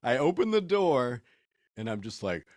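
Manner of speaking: normal talking voice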